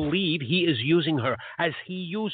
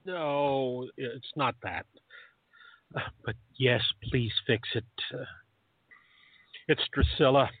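[name]'s voice; 6 semitones lower than usual. Acted. [name] character voice.

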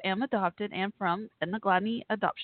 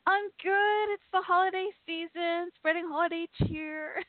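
chirpy and bright; 9 semitones higher than usual. Real, not acted. Host chirps to caller.

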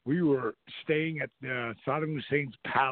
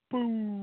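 higher, booming explosion noise; bomb boom imitation; 9 semitones higher than usual. Shown as a demonstration. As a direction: mimic the bomb going off. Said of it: "No, legit bombs, like".

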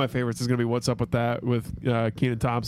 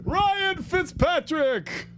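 announcer call-out; much slower than usual. Pretend. Calling out a name, drawn out.

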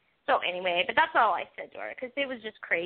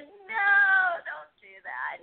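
pleading no; 8 semitones higher, much slower than usual. Retold or imitated. Reacting to TV scene.

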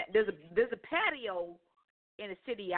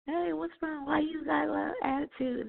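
a higher, put-on voice for the date; mimicking her date; 8 semitones higher than usual. Woman mocks her date asking about her attitude, raised mock voice. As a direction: play him, raised up and a bit mocking.

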